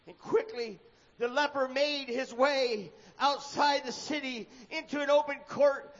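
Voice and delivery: ordinary delivery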